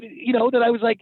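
ordinary talk, nothing marked

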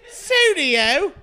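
high, loud mock British accent. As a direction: chirp it like a cheery Englishman. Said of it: "he goes".

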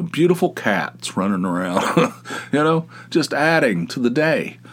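normal talking voice

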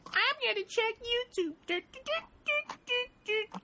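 very high, silly put-on voice; squeaky mockery trailing into do-do-do noises; mocking searcher. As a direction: mimic a clueless searcher in a squeaky pitch. Mocking people who go check YouTube.

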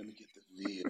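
creepy, hushed food order; inappropriate whisper. Pretend. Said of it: "so inappropriate with that whisper".

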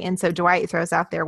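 ordinary talk, nothing marked

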